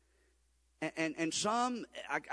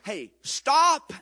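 preaching shout; 8 semitones higher, 10 dB louder than usual. Real, not acted. Preacher exhorting congregation.

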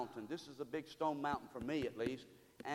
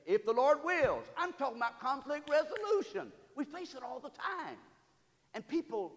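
higher, louder preacher's cry, rising to the point; preaching at full force; 10 semitones higher, 6 dB louder than usual. Acted. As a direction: call it out to the congregation like a preacher. Preaching climax.

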